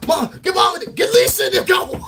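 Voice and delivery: mock panic screaming; panicked shout. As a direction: yell for everyone to run. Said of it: "He said".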